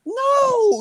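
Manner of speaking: very high, strained imitation of his outrage; mock movie tirade. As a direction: draw it out high in parody, building on each no.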